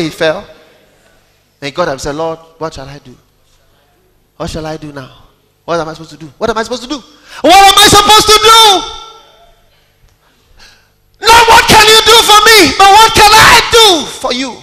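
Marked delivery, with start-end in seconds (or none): 2.1-7.0 soft humble plea. Retold or imitated. Voicing humble asking. 7.4-14.6 high, loud preaching cry; full-force preacher shout. Real, not acted. Preaching climax.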